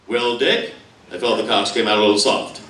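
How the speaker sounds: low-pitched commentator voice; deadpan sports announcer. Acted. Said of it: "how would the announcers be able to keep a straight face".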